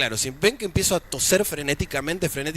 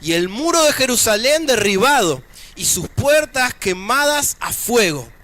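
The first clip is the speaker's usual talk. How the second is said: emphatic, projected Bible reading from the pulpit; preacher's raised, solemn reading voice; scripture reading; 6 semitones higher, 5 dB louder than usual. Acted. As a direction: proclaim the passage phrase by phrase. Reads scripture aloud to congregation.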